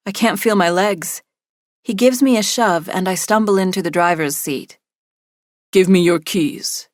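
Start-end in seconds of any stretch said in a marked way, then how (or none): 5.7-6.9 slow, firm command; orders. A narrator's reading. Kidnapper demanding her car keys, slow firm order. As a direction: lay down the order slowly. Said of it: "he orders".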